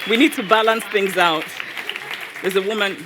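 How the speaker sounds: loud call across the room. Real, not acted. Host calls out over the crowd for a woman to ask. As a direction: raise the voice to pick someone out.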